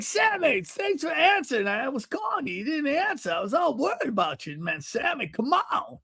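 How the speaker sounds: put-on high, fretting old-man voice; worried older man. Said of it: "It's how he talks".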